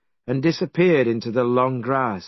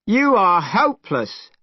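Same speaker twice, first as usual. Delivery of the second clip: angry shout; 7 semitones higher, 3 dB louder than usual. Acted. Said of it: "shouted Monkey".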